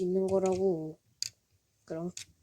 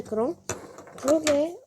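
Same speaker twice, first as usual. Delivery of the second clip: usual speaking voice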